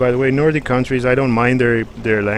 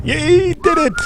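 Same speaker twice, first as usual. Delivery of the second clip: mock cheer. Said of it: "I thought I was, like".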